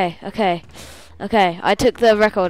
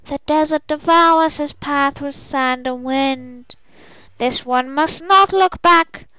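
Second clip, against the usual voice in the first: slow, drawn-out chant, rising higher; ethereal voice; 6 semitones higher, slower than usual. Acted. Spirit voice speaking to the traveller, ethereal chant. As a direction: intone it like an otherworldly oracle. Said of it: "you hear an ethereal voice".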